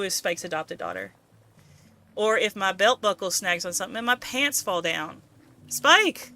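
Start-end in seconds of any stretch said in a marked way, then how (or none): 5.7-6.3 loud call with rising pitch. Acted. Calling out to character.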